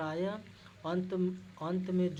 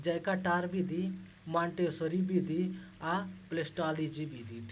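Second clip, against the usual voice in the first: slow, deliberate list for memorising; 3 dB louder, slower than usual. Shown as a demonstration. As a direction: spell out each method slowly. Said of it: "हमें याद रखना है".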